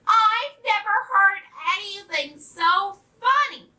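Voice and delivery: loud, high duck quack; tickled duck, loud and high-pitched; uproarious quacking. A narrator's reading. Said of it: "quacked uproariously".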